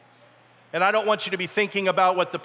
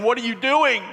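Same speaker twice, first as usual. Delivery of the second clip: higher, loud cry of disbelief; alarmed outcry; 9 semitones higher, 7 dB louder than usual. Acted. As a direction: call out as if stopping someone. Acts boatmen crying out in alarm.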